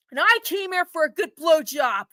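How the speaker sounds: normal talking voice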